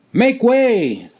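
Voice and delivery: high, loud barked command; loud demand. A narrator's reading. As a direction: call out to clear the hall. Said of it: "a loud, strong voice demanded".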